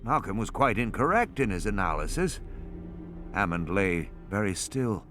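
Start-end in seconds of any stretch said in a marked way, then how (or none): none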